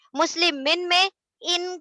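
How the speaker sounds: مسلمن said as muslimin, ان as "in"; short i in ان and closing مسلمن; "in" ending. Shown as a demonstration. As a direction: stress the "in" of مسلمن and ان. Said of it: "ان کی sound سنائی".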